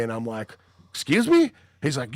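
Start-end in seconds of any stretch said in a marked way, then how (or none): 0.9-1.5 high-pitched incredulous question; retold affronted disbelief. Answering a stranger who cursed him at the bar, high affronted disbelief. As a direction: act out being taken aback.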